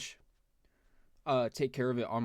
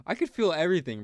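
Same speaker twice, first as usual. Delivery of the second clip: laughing it out, louder and higher; 6 semitones higher, 9 dB louder than usual. Real, not acted. Telling viewers the numbing failed, laughing loudly. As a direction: burst out laughing in disbelief.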